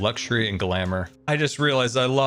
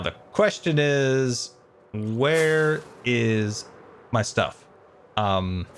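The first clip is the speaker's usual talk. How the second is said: normal talking voice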